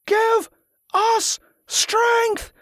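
high roared chant; crowd roar. A narrator's reading. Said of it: "the crowd roared back".

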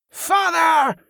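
high, ringing call. A narrator's reading. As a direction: call out to the crowd. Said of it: "Sedua called".

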